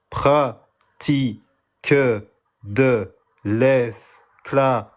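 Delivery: put-on very slow, word by word, like teaching a child; sounding out words. Shown as a demonstration. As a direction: spell it out slowly for a slow reader. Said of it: "comme j'apprends à ma fille de six ans à lire syllabe par syllabe".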